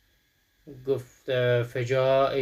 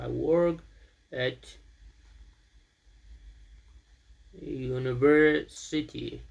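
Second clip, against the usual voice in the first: slow model sentence; much slower than usual. Shown as a demonstration.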